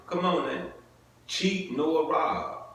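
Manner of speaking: normal talking voice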